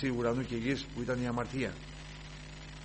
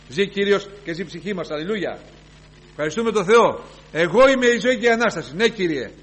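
loud, raised praise; fervent preaching; 6 semitones higher, 10 dB louder than usual. Real, not acted. Preacher praising God before church, fervent preaching. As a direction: lift the congregation with thanks.

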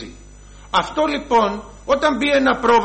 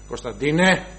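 low, heavy call to a son; father's deep voice; 7 semitones lower than usual. Shown as a demonstration. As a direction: summon the child in a deep voice. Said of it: "Εγώ έχω βαριά φωνή".

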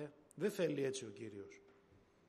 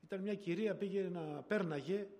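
ordinary delivery

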